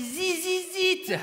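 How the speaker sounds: "Zizizit" high and very drawn out, z for th; mispronounced English. Retold or imitated. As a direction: stretch a buzzing "Zizizit", mocking her French accent. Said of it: "Elle m'a dit". (« Zizizit » aigu, étiré, avec des z)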